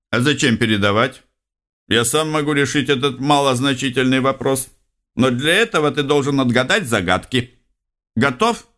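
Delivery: chirpy high program voice; lightly voiced cocky secretary; playful electronic secretary.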